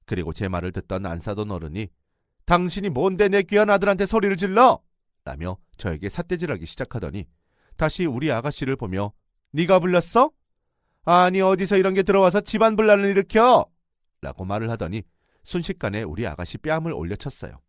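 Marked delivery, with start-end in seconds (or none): 2.5-4.8 angry yell. Retold or imitated. In-law berating narrator. 9.5-13.6 angry yell. Retold or imitated. In-law scolds her daughter-in-law.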